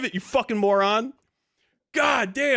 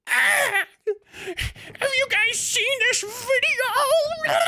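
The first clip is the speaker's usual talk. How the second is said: much higher, slow, cracking with laughter; laughing fit; 13 semitones higher, much slower than usual. Real, not acted. Streamer to chat, helpless laughter. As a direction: squeal through helpless laughter.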